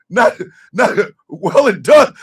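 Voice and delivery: normal talking voice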